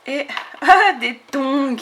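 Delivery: high, loud laughing exclamation; real amused squeal of surprise; delighted surprise. Real, not acted. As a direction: burst out laughing at a sudden discovery. Finds tiny flip-flops, laughs.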